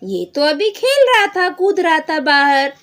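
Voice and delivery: loud, high astonished dad; surprised father. Acted. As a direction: exclaim as a baffled father. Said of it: "उसके पापा बोलते हैं". (हैरान पिता, ज़ोर से)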